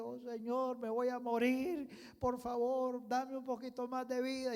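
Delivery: put-on high, loud begging like a weeping child; pleading cry. Hezekiah begging the Lord not to die, crying. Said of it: "comenzó a clamar y a pedir y a llorar como un niño".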